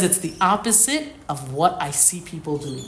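normal talking voice

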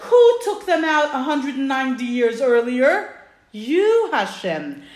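loud, high accusation, acted out; dramatised bold rebuttal; challenging retort. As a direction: throw the question back, then land the answer. Moshe throws blame back at God.

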